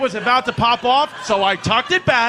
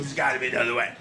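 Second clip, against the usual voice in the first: 更低更轻的老爸声音; low gruff dad; 8 semitones lower, 5 dB quieter, much faster than usual. Retold or imitated. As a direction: sink the voice low and soft, a mobster father bargaining. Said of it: "My dad goes".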